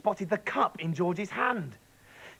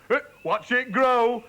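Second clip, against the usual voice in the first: higher, louder and slow; slow excited shout; 8 semitones higher, 8 dB louder than usual. Acted. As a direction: call out for all to watch. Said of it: "shouted Mister Cranky".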